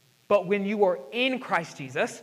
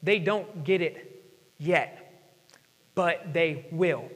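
slow, soft and lower; gentle patience; 4 semitones lower, 4 dB quieter, much slower than usual. Retold or imitated. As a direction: say it softly, full of hope. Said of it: "he said".

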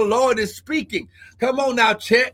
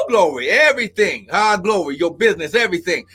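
ordinary delivery

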